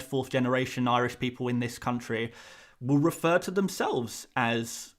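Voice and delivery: usual speaking voice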